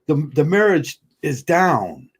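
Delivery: ordinary delivery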